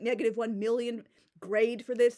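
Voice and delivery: normal talking voice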